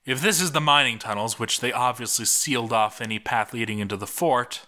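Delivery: ordinary delivery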